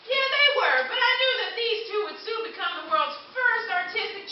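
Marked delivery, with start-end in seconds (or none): none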